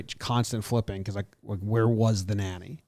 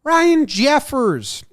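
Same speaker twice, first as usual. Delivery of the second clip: loud, much higher, drawn-out name call; announcer call; 13 semitones higher, 8 dB louder, much slower than usual. Acted. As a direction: belt the name like a stadium announcer.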